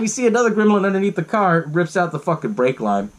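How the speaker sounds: ordinary delivery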